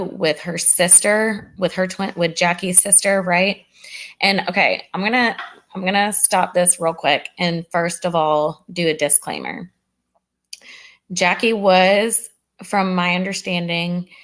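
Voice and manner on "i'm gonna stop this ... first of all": ordinary talk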